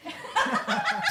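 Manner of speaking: sudden high laugh, no words; a squealing laugh breaks out; laughing at her own joke; high burst of laughter. Real, not acted.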